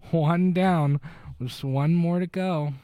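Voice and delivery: high, loud, slow matter-of-fact count; calmly ticking off a job, played up; mock calm. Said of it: "she's so calm".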